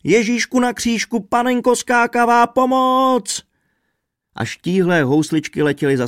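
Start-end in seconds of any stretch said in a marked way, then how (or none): 0.0-3.4 very high, loud panicked cry for help; terrified screech. A narrator's reading. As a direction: shriek to heaven for rescue. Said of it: "strašlivě zavřeštěl".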